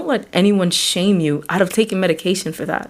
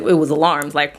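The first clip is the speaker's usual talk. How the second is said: usual speaking voice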